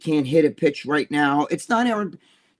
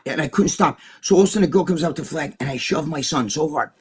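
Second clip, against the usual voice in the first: ordinary talk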